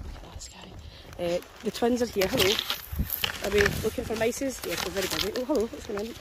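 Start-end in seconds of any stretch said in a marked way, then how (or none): 0.0-0.7 whisper. Real, not acted. Unvoiced aside.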